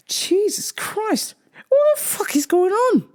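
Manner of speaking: high, strained incredulous squeal; can't believe this is happening; exasperated disbelief. Retold or imitated. Clerk alone round the corner after odd customer, exasperated disbelief.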